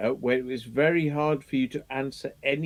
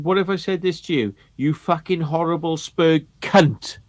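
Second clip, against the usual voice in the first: nasty mock insult; 5 semitones higher, 5 dB louder than usual. Retelling the judge's taunt in a nasty mock voice. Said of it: "such a fucking nasty manner".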